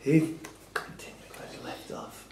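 breathy whispered aside. Real, not acted. Quizzer whispering a jibe about the quizmaster. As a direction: whisper it so the quizmaster can't hear.

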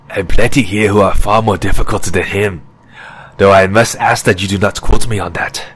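ordinary delivery, nothing marked

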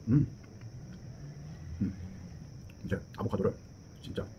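talking while chewing food; muffled closed-lip mumbling; chewing with mouth full. Real, not acted. Seen 1: Bites avocado at -1; cheeks full, lips closed, chewing throughout.

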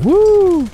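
high, loud "woo"; cheering whoop. Real, not acted. Player celebrating the won match, high cheering whoop. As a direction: let out one high, held whoop.

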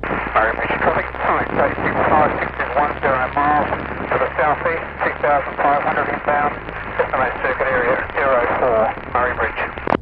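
tinny radio voice through static; distant pilot over the radio; crackly radio. Real, not acted.

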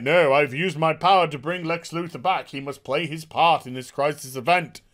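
pompous mock proclamation; Monitor. Reviewer mimicking the Monitor justifying himself, mock-solemn. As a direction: declaim it like a self-important cosmic being.

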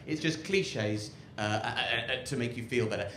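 ordinary talk

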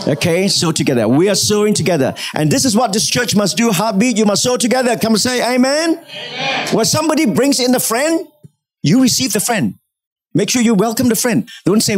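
high-pitched, rousing call to the congregation; fervent preaching. Real, not acted. Rallies the congregation to respond.

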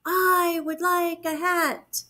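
high, slow, drawn-out echo; echoing moon. A narrator's reading. Moon echoes bear's wish back, slow high echo. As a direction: echo it slowly from far away. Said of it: "said the moon".

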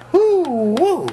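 loud high whoop. Real, not acted. Comic hyping the crowd with a loud whoop.

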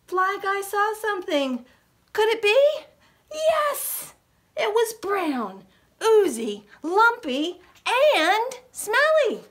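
rising delight at finding food; excited discovery. A narrator's reading. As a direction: gasp and build the excitement.

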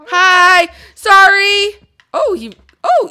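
high, loud startled hi and sorry; yelping at a sudden mob. Real, not acted. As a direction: jump and blurt an apology. Startled by a mob, yelps apology.

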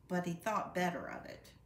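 ordinary delivery

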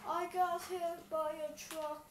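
slow mock whine. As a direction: drag it out in mock pain.

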